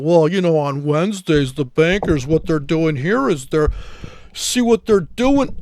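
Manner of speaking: a sneering take on a know-it-all trader, lightly voiced; mocking impression. Trader mocks a guru's claims about Wednesdays, sneering mimicry. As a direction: play the smug chart guru you're making fun of.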